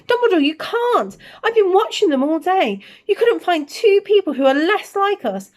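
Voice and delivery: outraged protest. Acted. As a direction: protest hotly, rising to a peak. McGonagall protesting.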